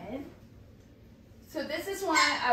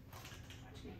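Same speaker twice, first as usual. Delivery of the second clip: whispering; 19 dB quieter than usual. Real, not acted. Whispered cue.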